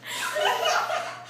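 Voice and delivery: breathless high wheeze of laughter; helpless silent laugh; silent wheezing laugh. Real, not acted. Reader losing it at her own story, wheezing laugh.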